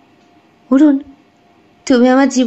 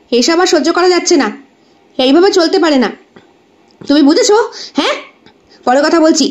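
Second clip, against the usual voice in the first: raised, higher-pitched exasperation; a touch of real anger, pitched up; angry phone call; 6 semitones higher, faster than usual. Acted. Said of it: "প্রচণ্ড রাগে লাল".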